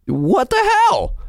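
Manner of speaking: high, slow disbelief; high-pitched what the hell; incredulous. Real, not acted. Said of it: "disbelief at the scene".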